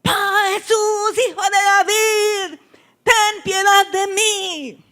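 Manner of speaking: high, long-drawn imploring cry; acted blind man's insistent clamor. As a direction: wail the plea, stretching every phrase. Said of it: "dice que gritaba más".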